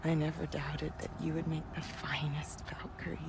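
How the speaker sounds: soft whispered confession. Acted. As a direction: breathe it close to her. Mother confides secret hope.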